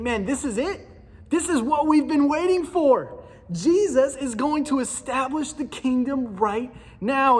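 imagine Peter thrilled that it's finally happening; excited Peter. Retold or imitated. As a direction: disciple bursting with excitement. Peter's imagined hyped thoughts.

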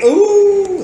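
high, sharp offended gasp of ooh; shocked ooh. Acted. Insulted woman reacting to the parrot, high shocked ooh. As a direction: squeal it like you've been insulted.

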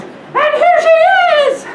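very high, loud squeak; piercing falsetto baby bear; wee little baby bear voice. Acted. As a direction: squeal it like an outraged tiny bear. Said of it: "in his wee little voice".

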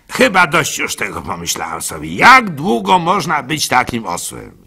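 ordinary delivery

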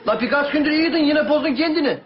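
exasperated Karadeniz scolding. Acted.

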